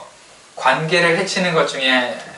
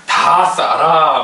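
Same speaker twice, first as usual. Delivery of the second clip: very slow, louder, stretched out; mock solemn intoning; drawn-out joke saying; 5 dB louder, much slower than usual. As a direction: deliver the punchline deadpan.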